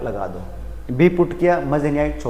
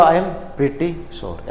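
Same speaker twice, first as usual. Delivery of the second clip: "I am pretty sure" said very slowly, each word apart; teacher modelling the right phrase slowly; slow correct model; 6 dB quieter, much slower than usual. Shown as a demonstration. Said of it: "सही क्या".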